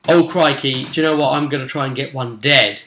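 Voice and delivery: ordinary talk, nothing marked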